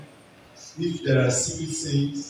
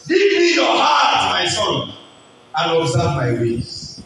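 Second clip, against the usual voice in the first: preacher shouting God's words, slow and commanding; 2 semitones higher, 6 dB louder, slower than usual. Acted. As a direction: boom out God's call to his son.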